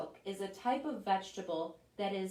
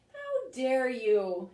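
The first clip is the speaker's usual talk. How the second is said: slow, louder, indignant; offended tomato; 2 semitones higher, 6 dB louder, slower than usual. Acted. Tomato answers the sweetness insult, slow and indignant. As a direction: draw it out, deeply insulted. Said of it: "said the tomato".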